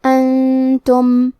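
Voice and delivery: انتم recited very slowly with noon hidden in a nasal hum; nasal ikhfa. Shown as a demonstration. Teacher demonstrates ghunna to students, slow nasal recitation. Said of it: "غنہ کے ساتھ ادا کریں گے".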